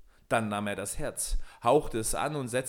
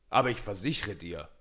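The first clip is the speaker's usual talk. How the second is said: indignant giant. A narrator's reading. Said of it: "rief Michel unmutig".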